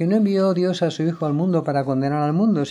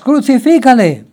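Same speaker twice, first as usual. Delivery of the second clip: higher, louder climactic yell; crowd screaming louder; 9 semitones higher, 9 dB louder than usual. A narrator's reading. The crowd screams at Pilate even louder, high full yell. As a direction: scream it harder than the crowd did before. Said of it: "gritaban aún más".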